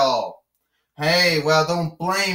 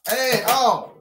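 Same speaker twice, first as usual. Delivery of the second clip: sudden higher yelp of shock; surprised cry; 7 semitones higher than usual. Pretend. Commentator reacts to the staff swing, surprised yelp. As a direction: jump up at the hit.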